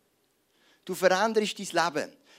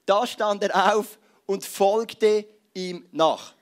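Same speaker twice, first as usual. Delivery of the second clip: solemn scripture reading; 2 semitones higher, 7 dB louder, much slower than usual. Shown as a demonstration. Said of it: "Können wir die mal haben?".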